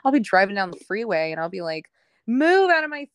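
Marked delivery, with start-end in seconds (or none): 2.3-3.1 much higher, louder angry shout at drivers; road rage yell. Retold or imitated. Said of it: "I have road rage".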